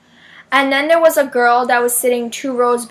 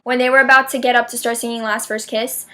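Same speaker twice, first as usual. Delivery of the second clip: ordinary talk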